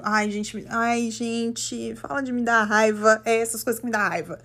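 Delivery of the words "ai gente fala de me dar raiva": ordinary talk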